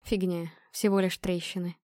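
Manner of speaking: quiet, understated reassurance; soft dismissal. A narrator's reading. Injured man plays down his cracked ribs, softly dismissive. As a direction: brush it off softly.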